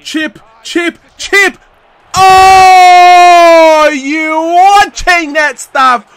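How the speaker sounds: high, very loud celebration; ecstatic shout. Real, not acted. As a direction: scream as the chip goes in. Goal celebration.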